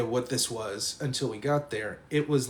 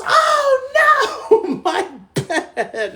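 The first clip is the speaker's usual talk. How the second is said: very high, loud wail; hammed-up distraught girl screaming; mock shriek; 11 dB louder, much slower than usual. Girl finding her bed wrecked, mock shriek.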